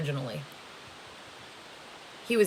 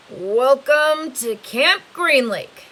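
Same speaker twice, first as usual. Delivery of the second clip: slow, louder and higher, drawn out; driver's announcement; 7 semitones higher, 9 dB louder, slower than usual. Acted. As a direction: announce the camp name like a weary driver. Driver announces arrival at camp.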